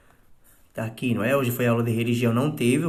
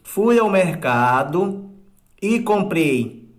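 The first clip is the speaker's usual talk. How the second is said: slow, measured reading of the example; slow dictation; 3 dB louder, slower than usual. Shown as a demonstration. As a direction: dictate it word by word. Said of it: "ó". (leitura lenta de ditado)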